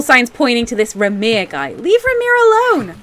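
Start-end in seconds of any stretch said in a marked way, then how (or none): 1.7-2.9 much higher, indignant defence of a friend; protective outburst. Acted. Nadine defending Ramir from the guard, high and indignant. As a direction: snap back high and outraged.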